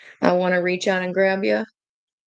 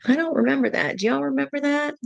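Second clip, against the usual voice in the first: much higher, incredulous questioning; retold disbelief; 8 semitones higher, faster than usual. Telling viewers she doubts Marvin said that, incredulous and high. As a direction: act baffled that you don't remember it. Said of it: "I'm like".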